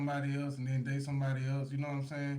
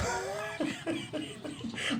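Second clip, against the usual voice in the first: airy high-pitched laughter; high breathy laugh; 13 semitones higher than usual. Real, not acted. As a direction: burst out in a wheezy laugh. Laughing at the funny video.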